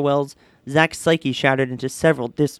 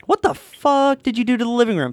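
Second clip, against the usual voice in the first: mock shout; 8 semitones higher than usual. A narrator's reading. Girlfriend finding the living room wrecked, acted shout. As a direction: demand an answer in raised pitch. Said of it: "Tess shouted".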